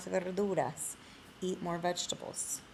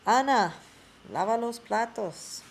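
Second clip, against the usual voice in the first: loud call, a father giving orders; father ordering his daughter to do chores; dad calling Ana; 4 semitones higher, 6 dB louder than usual. Acted. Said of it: "el papá de Ana is really telling her".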